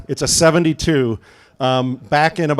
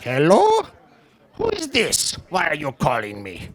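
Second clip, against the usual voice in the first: put-on thick German accent; 3 semitones higher than usual. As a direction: voice the old German guy, gruff and suspicious. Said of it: "Old German, thick accent".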